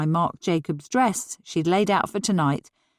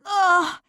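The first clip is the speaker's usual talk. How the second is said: exasperated cry; 11 semitones higher than usual. A narrator's reading. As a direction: vent the disaster in one cry. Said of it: "she cried again".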